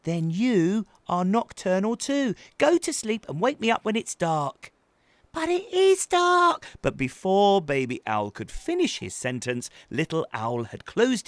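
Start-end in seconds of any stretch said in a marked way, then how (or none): 0.0-4.7 higher, louder telling-off; firm big-brother owl; bossy brother. A narrator's reading. Brother orders Baby Owl to sleep. 5.3-6.6 high, loud protest with IS punched; squeaky baby owl stressing IS. A narrator's reading.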